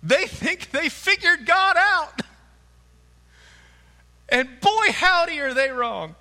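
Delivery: high, loud preacher's scorn; mocking. Real, not acted. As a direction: poke fun at their certainty. Preacher mocks disciples' overconfidence.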